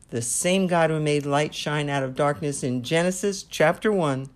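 normal talking voice

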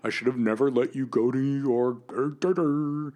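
hammed-up mock parent voice. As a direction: lampoon the clueless parents. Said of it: "and were like".

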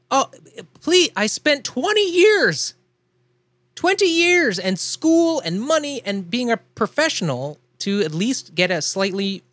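venting a pet peeve, drawn out; exasperated rant. Real, not acted. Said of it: "anyway, that's my".

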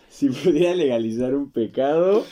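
flustered, laughing through it; nervous laughing. Real, not acted. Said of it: "Nervio, nervio".

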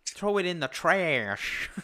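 high, drawn-out American parody; mock American accent. Brit teasing American vocabulary, high mock American accent. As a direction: stretch out a hammed-up American accent. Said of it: "I've never heard an American say".

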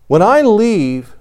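ordinary delivery

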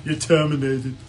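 a hint of a clipped Austrian action hero; Arnold impression. Co-host answering with his own take on Arnold's line, lightly done. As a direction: drop it like a movie catchphrase. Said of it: "That was a bad one".

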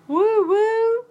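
high, loud whoop; mock party cheer. Cheering a pretend Super Bowl party, high loud whoop. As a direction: cheer like a hyped-up party crowd.

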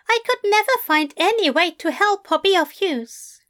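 a prim bookish angel confessing lightly; Aziraphale voice. Acted.

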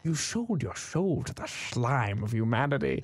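slow Scottish Sean Connery voice, every S said as "sh"; "slime" as "shlime", drawn out and grave; Sean Connery impression. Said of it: "he says everything with an S H".

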